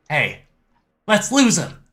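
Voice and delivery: high, loud gleeful shout; gleeful taunt. Acted. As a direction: call it out like a cocky getaway driver. NPC mouths a taunt.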